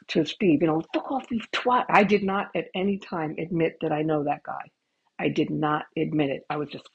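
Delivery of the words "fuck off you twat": high mock insult; imitating the bus driver. Bus driver insulting Steve, high mock yell. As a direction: spit out the insult pitched up. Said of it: "the bus driver's like".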